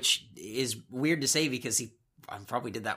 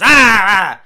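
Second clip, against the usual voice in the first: loud mock scream; imitated screech, higher and much louder; Stephanie; 5 semitones higher, 16 dB louder than usual. Podcaster imitating Stephanie's outburst, mock scream. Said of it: "Stephanie goes".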